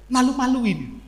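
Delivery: ordinary delivery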